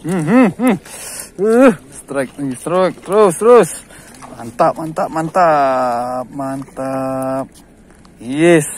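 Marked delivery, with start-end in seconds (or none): 0.1-3.7 loud, higher, urgent cheer; excited at a strike. Real, not acted. Angler cheering on a fresh strike, excited. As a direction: call the strike with rising excitement.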